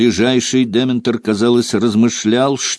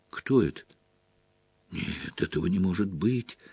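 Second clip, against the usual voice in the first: stunned whisper; 11 dB quieter than usual. A narrator's reading. Harry stunned in disbelief.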